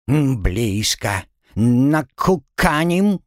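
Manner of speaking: slow drawn parrot voice; bird folk. Acted. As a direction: drawl it like a parrot. Parrot squawks answer.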